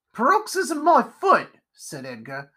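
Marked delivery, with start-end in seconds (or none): 0.1-1.5 very high, scornful squawk; high-pitched crotchety dismissal; scoffing spider character. Acted. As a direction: throw the word back in derision.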